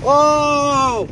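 high loud wailing whoa; imitated patient moan. Imitating a hospital patient moaning down the hall, high loud wail. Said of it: "she's going".

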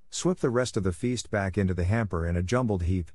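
ordinary delivery

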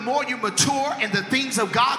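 high, ringing, half-sung preaching, slowed; full-force preaching. Acted. Preacher whooping about Jesus and the cross to the church.

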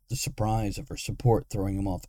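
usual speaking voice

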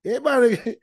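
normal talking voice

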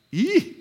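wincing squeal. Real, not acted. Reacts to a blunt verse.

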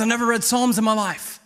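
mildly yelled, pushy father; imitated yelling dad. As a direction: play the worked-up dad confessing loudly. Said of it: "Quit yelling at me".

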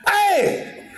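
high, far-off call from another room; family member yelling from back room, high mock yell. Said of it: "screaming out the back room".

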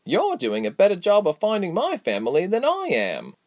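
high, playful ribbing; lightly cheeky, sing-song character voice; teasing wink. A narrator's reading. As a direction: tease a friend in a lifted voice. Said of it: "She winked as she said".

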